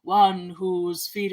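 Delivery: slow, lightly booming prophet; a hint of a dark prophet, drawn out slowly. Prophet foretells the one to come, slow dark boom. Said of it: "a booming, dark".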